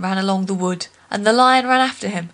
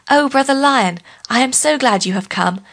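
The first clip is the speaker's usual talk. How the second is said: fawning greeting; 4 semitones higher than usual. Acted. Sly Anansi greets the lion.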